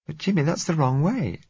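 put-on high, anxious young woman; frightened girl voice. A narrator's reading. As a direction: voice a scared girl pleading.

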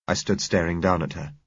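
ordinary delivery

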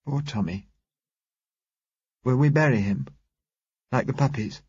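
put-on high child's voice; sorrowful little girl. A narrator's reading. Girl asks driver about burying Tommy, sorrowful child. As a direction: mourn in a small girl's high voice.

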